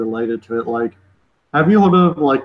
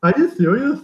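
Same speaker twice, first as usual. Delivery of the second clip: mock disbelief; 11 semitones higher than usual. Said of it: "I'm like".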